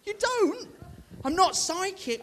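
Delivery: loud emphatic no; firm denial. Retold or imitated. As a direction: shut the idea down firmly. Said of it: "I said".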